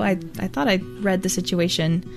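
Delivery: ordinary delivery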